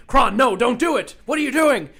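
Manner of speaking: loud, pleading shout. Retold or imitated. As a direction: yell to stop him. Pierre shouting at Kron.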